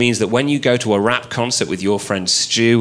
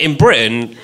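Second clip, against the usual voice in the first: normal talking voice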